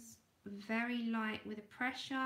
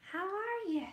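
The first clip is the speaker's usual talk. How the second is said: attempted Irish accent; 9 semitones higher, 4 dB louder than usual. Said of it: "I can't do the Irish accent to save my life".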